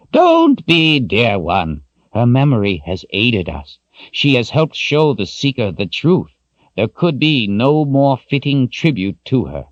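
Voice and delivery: a hint of the old wizard, high and kind; comforting old wizard. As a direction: reassure her gently. Zed comforts Kalen.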